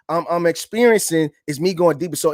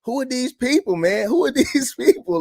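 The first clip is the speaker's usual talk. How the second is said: incredulous; 10 semitones higher than usual. Retold or imitated. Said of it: "And I'm like".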